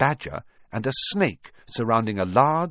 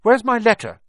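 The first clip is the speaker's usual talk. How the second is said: high, loud challenge; indignant demand; 9 dB louder than usual. A narrator's reading. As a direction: confront your uncle at once. Boy confronts uncle over stolen letter.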